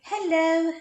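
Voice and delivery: put-on high British-accented hello; faked British greeting, high and drawn out; fake British voice. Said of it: "really good at faking British voice".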